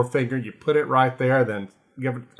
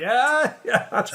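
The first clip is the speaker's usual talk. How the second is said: laughing yeah; 13 semitones higher than usual. Retold or imitated. Laughing reply to Stoppard's joke.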